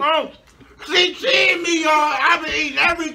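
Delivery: high, loud accusing shout; outraged shout. Real, not acted. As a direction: call her out loudly. Partner skipped the bite.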